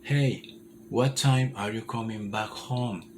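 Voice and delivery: slow, careful model sentence; slow English demo. Shown as a demonstration. Teacher modeling a phrase slowly for students, slow. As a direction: say it slowly for the learner. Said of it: "Comienza despacio".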